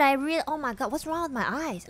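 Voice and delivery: usual speaking voice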